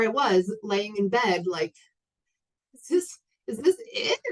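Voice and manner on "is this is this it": much higher, incredulous mock lament; acted disbelieving thought. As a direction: act out a baffled question to yourself, high-pitched. Retells despairing thought in bed.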